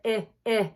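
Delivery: I said as a short "ih"; I, I said twice as a short "ih"; short I sound. Shown as a demonstration. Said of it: "I have a short I sound".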